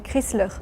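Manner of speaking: Chrysler as "krees-lerr"; French Chrysler, "krisleur"; French pronunciation. Shown as a demonstration. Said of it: "In France".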